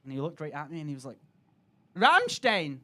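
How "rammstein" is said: louder flat one-word reply; lightly voiced serious kid; deadpan kid answer. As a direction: state it like it's obvious.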